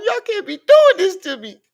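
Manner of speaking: hammed-up sobbing wail, pitched high; overdone mock sob, crying out high. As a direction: fake a crying shout of betrayal. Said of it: "Y'all know I'm the emotional one".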